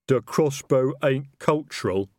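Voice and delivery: a troll fumbling for words in his suit; slow troll. A narrator's reading.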